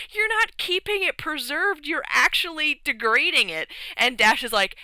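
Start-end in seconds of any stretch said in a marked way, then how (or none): 0.1-3.7 high-pitched mock exasperation; acted-out indignant telling-off; exasperated correction. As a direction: protest as if it's painfully obvious. Everyone corrects him in exasperation.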